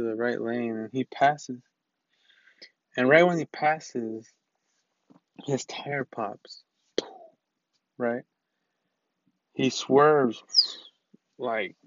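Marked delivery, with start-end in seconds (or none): 5.5-6.6 hushed, slowed suspense whisper; dramatic whisper. Real, not acted. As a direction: drop to a slow whisper for the big moment. Dramatic moment of crash story.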